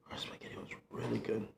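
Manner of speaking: hushed confiding whisper. Shown as a demonstration. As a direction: repeat it in a breathy whisper.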